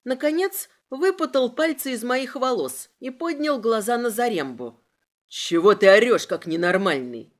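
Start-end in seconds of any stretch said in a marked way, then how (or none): 5.3-7.3 loud, low rebuke; angry low-pitched inspector; irritated outburst. A narrator's reading. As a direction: burst out loudly, then drop. Inspector snaps at his man.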